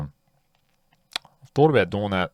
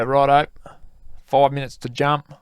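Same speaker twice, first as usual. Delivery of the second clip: retold call-out; 8 semitones higher, 5 dB louder than usual. Instructor calls out jump warning.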